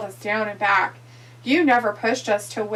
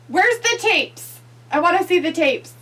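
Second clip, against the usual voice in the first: demanding shout; 8 semitones higher, 6 dB louder than usual. Retold or imitated. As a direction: insist on seeing the tapes. Demanding the tapes.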